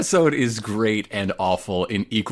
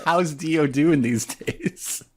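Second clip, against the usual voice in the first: slow mock-casual question; 3 semitones higher, slower than usual. Cohost repeats Avdol's blunt line.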